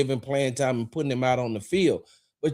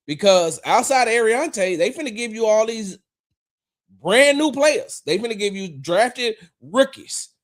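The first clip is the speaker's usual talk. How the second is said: excited rant; 8 semitones higher, 4 dB louder than usual. Real, not acted. Ranting about rookies.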